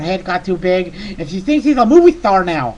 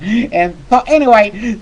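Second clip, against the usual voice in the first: normal talking voice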